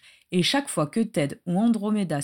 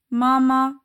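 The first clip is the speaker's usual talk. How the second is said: put-on high, loud little-child voice; bright-pitched toddler's babble, held on one high note; babbling toddler; 6 semitones higher, 6 dB louder than usual. A narrator's reading. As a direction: chirp it like a two-year-old calling for mother. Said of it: "gazouilla Nymphadora".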